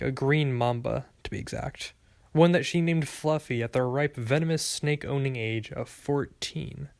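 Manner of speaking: ordinary delivery, nothing marked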